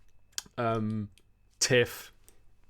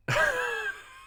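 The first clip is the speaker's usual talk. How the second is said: high giggle. Real, not acted. Remembering old nickname.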